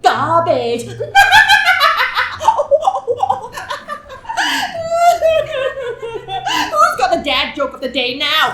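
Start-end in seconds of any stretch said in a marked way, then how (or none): 0.9-5.6 high burst of laughter; big laugh. Acted. Host cracking up at the dad joke, loud laughter.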